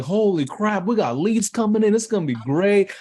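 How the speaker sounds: high and loud, overjoyed; giddy cheer at the leads; ecstatic. Retold or imitated. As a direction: shout the good news to yourself. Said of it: "I'm ecstatic because I'm like".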